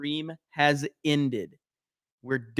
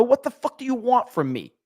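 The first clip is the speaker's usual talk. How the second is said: exasperated; 8 semitones higher than usual. Real, not acted. Exasperated at audio complaints.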